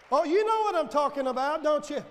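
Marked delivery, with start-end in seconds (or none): none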